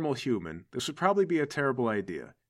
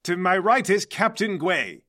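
louder, higher grand introduction; Mercury, formal villain boss; 5 semitones higher, 6 dB louder than usual. Acted. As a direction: announce a guest like a theatrical mastermind. Game master plays Mercury.

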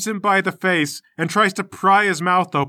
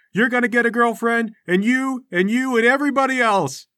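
rousing speech; 6 semitones higher than usual. Acted. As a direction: stir up the crowd, voice pitched up.

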